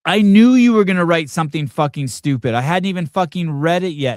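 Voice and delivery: loud, high furious yelling at the writer; angry rant. Real, not acted. Ranting at the article writer, loud and furious. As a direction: blow up at the article before reading it.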